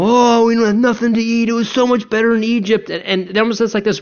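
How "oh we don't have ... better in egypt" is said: higher, whining complaint; whiny prayer. Retold or imitated. Israelites grumbling to God, high whiny complaint. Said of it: "like a whiny prayer".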